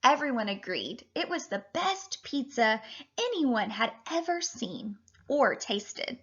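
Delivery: ordinary talk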